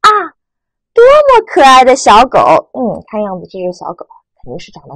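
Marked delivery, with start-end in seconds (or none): 0.0-2.4 admiring exclamation. Shown as a demonstration. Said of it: "读第四声". (赞叹的语气，慢慢读)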